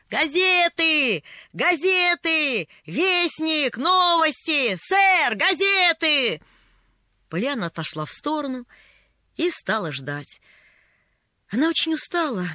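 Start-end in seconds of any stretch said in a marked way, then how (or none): 0.1-6.4 high, drawn-out newsboy's call; street vendor's cry. A narrator's reading. Newsboy hawks papers to passers-by, calling out. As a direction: call out wares, stretching each word. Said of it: "как он кричал".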